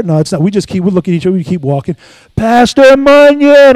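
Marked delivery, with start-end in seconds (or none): 2.3-3.8 loud, high, slow call stretched out; insistent long-distance hail; calling out by name. Retold or imitated. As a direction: shout his name across the street. Caller insisting with full name.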